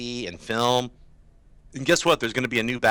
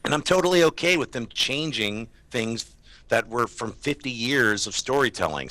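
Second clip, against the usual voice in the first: ordinary talk, nothing marked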